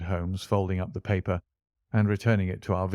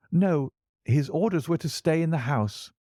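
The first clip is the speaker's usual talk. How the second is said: put-on lightly raised, earnest young man's voice; a touch higher, worried young gentleman; young client John Openshaw; 7 semitones higher than usual. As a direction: tell your troubles as the anxious young visitor. Young visitor's held voice.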